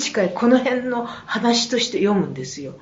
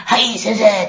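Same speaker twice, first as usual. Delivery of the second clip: chest voice students; 7 dB louder, slower than usual. Retold or imitated. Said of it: "早稲田の子たちも地声で".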